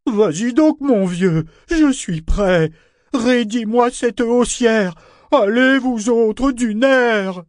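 loud, high, drawn-out orders; irritated shouting. A narrator's reading. Ship urging the crew to haul, irritated shouting. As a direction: bellow impatient commands at the crew. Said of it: "s'écria-t-il irrité".

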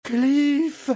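appel aigu et perçant; elle crie son nom dans la fumée; cri. A narrator's reading. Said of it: "s'écria-t-elle". (cri aigu vers Cliff)

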